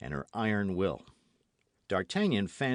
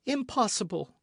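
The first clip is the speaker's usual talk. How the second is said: high, sharp cry of disbelief; cried out; 14 semitones higher than usual. A narrator's reading. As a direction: cry it out, much higher. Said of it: "cried Milady".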